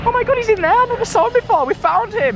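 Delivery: high-pitched, thrilled exclamation; squeaky, playful amazement; excited discovery. Pretend. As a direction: cry out as if spotting someone famous. Mock discovery during Potter play.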